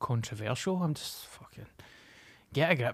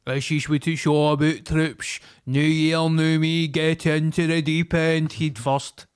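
loud, raised mock hype voice; motivational slogan voice; 3 semitones higher, 6 dB louder, slower than usual. Rallying the troops for New Year, loud hammed-up hype. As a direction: belt it out like a motivational rallying cry.

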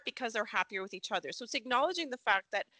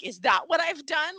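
mock-smug quote, higher and louder; 6 semitones higher, 5 dB louder than usual. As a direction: play him all smug, voice raised. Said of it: "Like, all smug".

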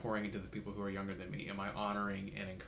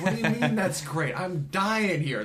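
much higher and louder, laughing in disbelief; mock exasperation; 10 semitones higher, 13 dB louder, slower than usual. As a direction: protest like you're drowning. Exasperated reply to the mentors.

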